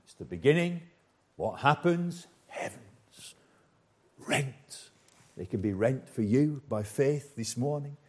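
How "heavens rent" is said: a reverent whisper, as if revealing a secret; dramatic whisper. Acted. Preacher whispering of heaven torn open, hushed. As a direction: breathe the words out in a hush.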